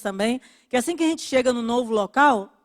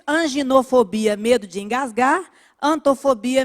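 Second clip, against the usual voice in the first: ordinary talk, nothing marked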